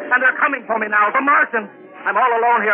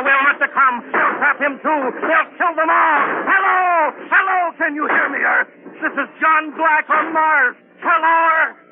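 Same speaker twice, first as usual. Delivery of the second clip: trapped captain pleading into dead radio; desperate calling; 3 semitones higher than usual. Acted. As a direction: shout into the radio hoping Earth hears. Trapped captain calling Earth frantically.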